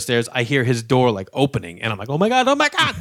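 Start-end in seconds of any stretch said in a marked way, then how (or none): 2.1-3.0 high, climbing panicky yelp; panic, rising higher on the repeat; mock panic. Man fleeing as the door opens, hammed-up panic. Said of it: "It was just scary".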